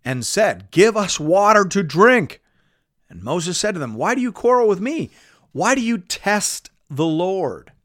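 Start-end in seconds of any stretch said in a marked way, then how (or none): 0.7-2.4 loud, high-pitched demand; quarrelsome demand. A narrator's reading. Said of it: "the people quarreled with Moses". 3.9-7.7 higher-pitched challenging questions; reproachful challenge. A narrator's reading. Moses answers the quarreling people.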